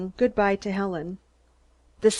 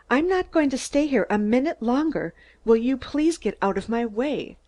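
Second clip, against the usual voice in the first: ordinary delivery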